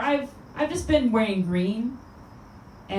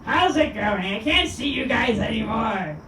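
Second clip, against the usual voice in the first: Gilbert Gottfried impression; 2 semitones higher, slower than usual. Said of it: "I'm gonna end with Gilbert Gottfried".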